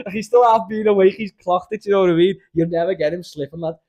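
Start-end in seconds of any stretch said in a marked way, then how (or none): 0.1-2.3 high, loud, laughing through the words. Real, not acted. Laughing while talking.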